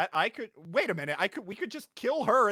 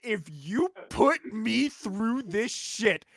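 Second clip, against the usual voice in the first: slow, rising to a loud threat; menacing threat; 4 semitones higher, 8 dB louder than usual. Acted. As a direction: threaten him, building to a roar. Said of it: "stare this little fucker dead in the eye and say".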